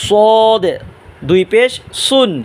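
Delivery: "সদ" and "সুন" with a heavy, rounded emphatic s; thick S. Shown as a demonstration. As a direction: thicken and draw out "সদ". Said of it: "সদ মোটা আওয়াজে উচ্চারণ করবেন".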